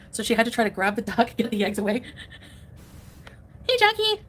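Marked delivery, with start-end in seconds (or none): 3.7-4.2 much higher, brighter call to the pet; high pet greeting. Real, not acted. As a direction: sing out the pet's name.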